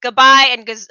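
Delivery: imitating grandma's German farewell; grandma's goodbye. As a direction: start grandma's farewell line with gusto. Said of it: "as my grandma would say".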